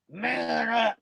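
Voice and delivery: a hint of a tough-guy growl; mock Jose's macho order; lightly put-on man voice. Quoting Jose screaming at the chat, light mock man voice. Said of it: "and he puts his man voice on".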